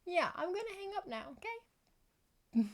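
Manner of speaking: mock phone goodbye. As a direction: sing it up high like ending a phone call. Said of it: "I almost just said hang up".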